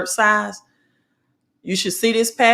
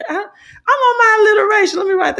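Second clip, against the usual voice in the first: laughing; 14 semitones higher, 5 dB louder, faster than usual. Real, not acted. Laughing at her own wordplay.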